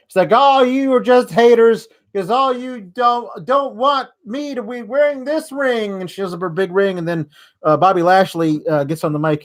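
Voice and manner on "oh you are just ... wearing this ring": much higher, mock-petulant whine at the crowd; pissy mock whine. Lana scolding the crowd, higher pissy mock whine. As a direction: sneer like a spoiled wife. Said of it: "Lana's really pissy at the crowd".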